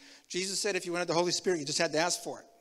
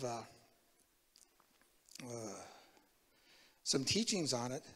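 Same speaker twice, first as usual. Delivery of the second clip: ordinary talk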